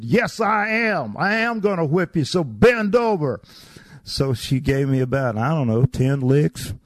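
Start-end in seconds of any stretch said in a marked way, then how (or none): 0.0-3.4 stern house mother. Retold or imitated. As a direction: lay down the punishment deliberately. House mother announces whipping.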